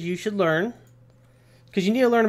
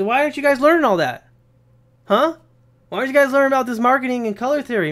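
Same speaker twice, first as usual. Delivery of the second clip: higher, fast, playful badgering; mock scolding; 7 semitones higher, faster than usual. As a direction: give them a hard time, jokingly. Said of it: "I'm just giving you a hard time".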